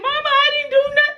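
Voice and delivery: put-on high and fast childish whine; high rushed child's voice; whiny child denial. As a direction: squeak a quick lie like a guilty kid. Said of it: "She was like".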